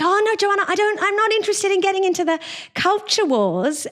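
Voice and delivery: high, lilting, mock-sweet voice; sing-song voice. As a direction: imitate her sugary high sing-song. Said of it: "this really annoying sing-song voice".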